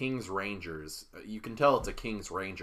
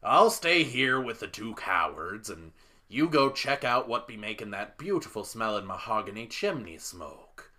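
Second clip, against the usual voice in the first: lively captain, louder at the start and trailing off; 2 semitones higher, 4 dB louder than usual. Acted. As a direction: give the orders as the captain. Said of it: "and the captain says".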